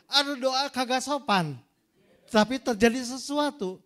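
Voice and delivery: loud, high preaching at full force; forceful preaching. Acted. Preacher asking the congregation which prayer is better, loud and forceful. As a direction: hammer the lesson home to the congregation. Preacher drives home the point.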